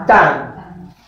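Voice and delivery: "Tan" as the stressed syllable, pitched low; strong-accent syllable. Shown as a demonstration. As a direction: give "Tan" alone, falling low. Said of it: "¿Cuál es el acento fuerte?".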